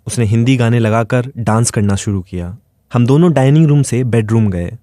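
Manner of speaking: ordinary delivery, nothing marked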